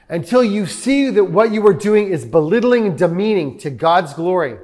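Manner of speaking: ordinary talk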